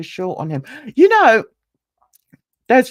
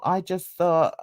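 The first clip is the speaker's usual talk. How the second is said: ordinary delivery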